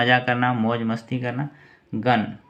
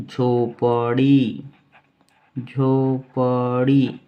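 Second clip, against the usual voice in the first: slow drawn-out word; 3 dB louder, much slower than usual. Shown as a demonstration. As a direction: say झोपड़ी very slowly, syllable by syllable. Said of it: "teacher stretches word for students". (झोपड़ी को बहुत धीरे खींचकर बोलें)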